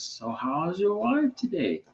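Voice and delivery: high, slow mimicry of a Nigerian woman's greeting; lightly put-on Nigerian accent, drawn out and lilting; imitating a Nigerian accent. Man retelling how his wife's friend phoned, in a mock Nigerian accent. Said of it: "they'd have that accent".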